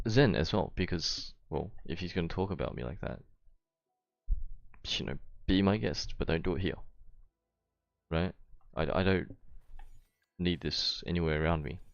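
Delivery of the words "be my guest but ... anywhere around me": ordinary talk, nothing marked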